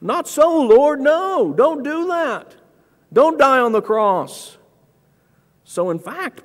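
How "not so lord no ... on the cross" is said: high, loud, drawn-out acted plea; pleading objection. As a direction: urge him not to, voice pitched way up. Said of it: "wanted to convince Christ not to".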